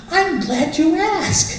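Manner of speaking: high, slow, sing-song cheerfulness; sugary wife voice. Retold or imitated. Wife cheerfully answering her gloomy husband, high and slow.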